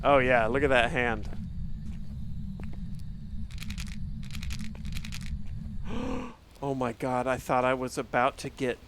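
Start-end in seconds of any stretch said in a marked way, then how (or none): 1.3-6.3 long breathy scared gasp; startled gasp. Real, not acted. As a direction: gasp as the ghost nearly kills you. Scared by ghost.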